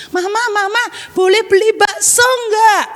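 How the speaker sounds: put-on eager child asking. Child asks mama to buy bakso, eager. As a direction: lightly play a child asking permission.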